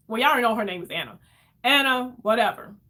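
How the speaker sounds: plain reading voice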